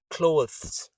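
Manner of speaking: "clothes" with th and s run together; said fast. Shown as a demonstration. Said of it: "When you say it fast".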